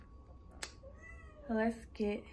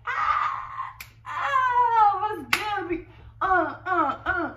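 hype shout; 6 semitones higher, much slower than usual. Acted. Skit, hyped shouting.

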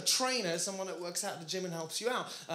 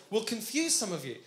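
ordinary talk, nothing marked

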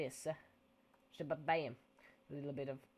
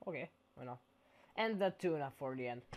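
normal talking voice

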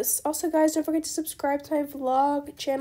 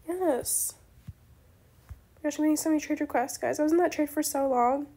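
ordinary delivery

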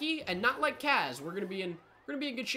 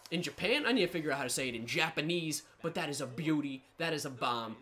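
normal talking voice

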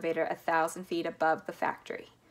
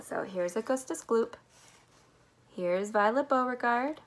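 ordinary delivery

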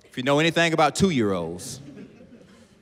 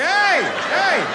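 loud, very high startled "hey"; retold dad's panicked yelp; scolding shout; 6 dB louder than usual. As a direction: cut the child off in alarm. Dad cuts off toddler's swearing.

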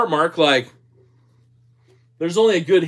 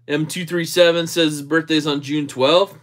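ordinary talk

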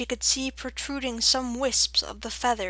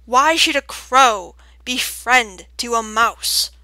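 loud, slow, sonorous owl; unbelieving; 9 dB louder than usual. A narrator's reading. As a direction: question it with ponderous disbelief. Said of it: "The sonorous voice sounded unbelieving".

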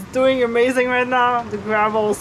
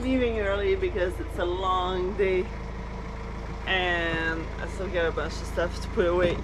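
softer, low-energy early-morning voice; tired and quiet; 4 semitones lower, 7 dB quieter than usual. Real, not acted. Mom talking softly while the kids sleep, tired and hushed. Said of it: "I am tired this morning".

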